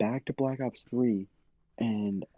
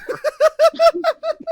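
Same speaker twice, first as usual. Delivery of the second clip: loud high-pitched cackle; loud high laugh; 12 dB louder than usual. Real, not acted. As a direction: shriek with laughter. Laughter burst.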